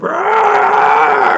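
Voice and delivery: high loud monster shout; zombie roar. Retold or imitated. Imitating zombies charging at humans, loud roar. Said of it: "That's when they get".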